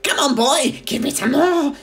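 put-on a wild old witch crowing with glee; screechy old grandma shout. A narrator's reading. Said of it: "she yelled".

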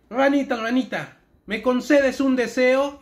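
llama a la rana fuerte y lento; invocación en voz alta. Acted. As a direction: recita las palabras mágicas en voz alta y más aguda. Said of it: "Aquí va". (conjuro en voz alta, más agudo y lento)

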